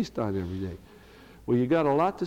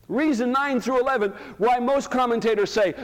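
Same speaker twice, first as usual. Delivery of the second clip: loud, much higher preaching burst; forceful preaching; 10 semitones higher, 5 dB louder than usual. Real, not acted. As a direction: drive the point home to the church. Preacher raising voice at congregation.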